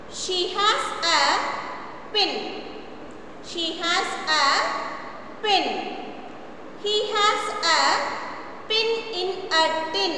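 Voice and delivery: very slow, every word spaced; slow model reading. Shown as a demonstration. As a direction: read it slowly for children to repeat.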